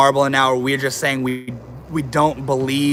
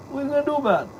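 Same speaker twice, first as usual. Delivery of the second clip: pet talk; 13 semitones higher, 4 dB quieter, much faster than usual. Real, not acted. Streamer dares his pet once more, squeaky baby voice. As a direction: repeat the squeaky dare gently.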